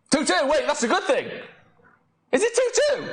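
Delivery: high, rising excitement as the score sinks in; can't believe what he's seeing; excited disbelief. Real, not acted. Streamer checking the score live, getting excited. Late equaliser in the watched match.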